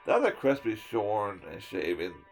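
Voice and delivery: ordinary delivery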